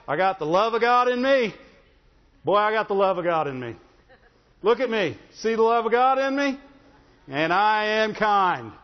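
loud, high, swaggering mock boast; hammed-up bragging with a laugh in it; boastful believer. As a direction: strut and brag like a show-off saint. Mocking proud boastful believer.

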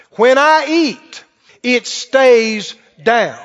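very slow, each word stretched out; emphatic declaration. Retold or imitated. Preacher coaching the sick sister to declare it, slow and emphatic.

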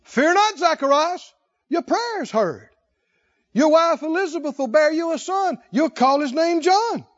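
high, loud angel proclamation; preaching the angel. Acted. Preacher voicing the angel to Zacharias, loud and high. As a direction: proclaim it like Gabriel himself.

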